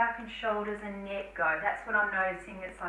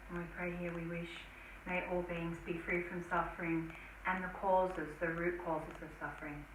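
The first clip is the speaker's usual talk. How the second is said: prayer; 4 semitones lower than usual. Acted. Om and dedication prayer.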